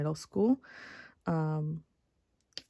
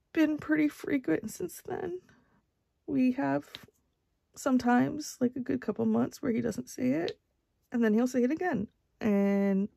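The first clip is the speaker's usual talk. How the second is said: crying; 5 semitones higher than usual. Real, not acted. Crying through the story.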